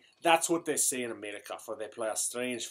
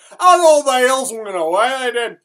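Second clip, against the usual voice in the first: loud, high triumphant peak; 10 semitones higher, 14 dB louder than usual. Acted. Storyteller crowing at the end of his miracle tale, loud and triumphant. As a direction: crow it out in triumph.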